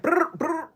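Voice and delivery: high rolled brr ad-lib; rapper ad-lib brr. Retold or imitated. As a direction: hype trill like a rapper. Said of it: "tell [name] I said".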